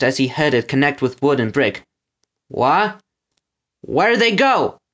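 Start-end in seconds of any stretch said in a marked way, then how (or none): 2.5-4.8 raised-pitch baffled bellow; bellowed question. A narrator's reading. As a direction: bellow your confusion. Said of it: "Gristle bellowed".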